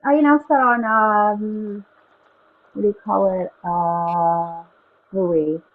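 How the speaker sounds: muffled, like underwater in a cave; talking through a bad earbud mic. Real, not acted. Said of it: "you sound all muffled".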